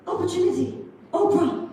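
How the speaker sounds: high, loud burst of mock triumph; excited. Trainee just hired at the call center, excited shout. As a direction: cry it out like a big winner. Acting out joy at being hired.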